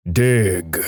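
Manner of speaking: hint of a voice from above; mock booming command, no real volume. Voice from above commands a depressed man, mildly booming. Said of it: "he hears a booming voice from above yell out".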